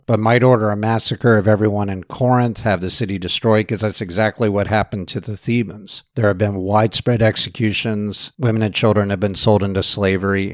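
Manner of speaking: ordinary delivery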